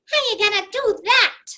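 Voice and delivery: high, loud skeptical little voice; a squeaky voice full of doubt; doubting inner voice. Retold or imitated. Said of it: "Or one that says".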